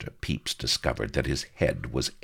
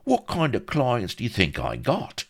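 higher, protesting, easing as it goes; indignant fixer; 8 semitones higher, 5 dB louder than usual. A narrator's reading. Vladimir defending his client to Pepys, indignant. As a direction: start affronted, settle into sly pleading. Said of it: "exclaimed the Fink indignantly".

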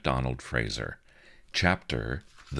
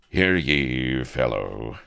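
cold, cutting archaic challenge; each word drawn like a blade; acidic; 6 dB louder, slower than usual. A narrator's reading. Captive Avery scorns his fellow prisoner, acidly. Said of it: "each acidic word was like a blade".

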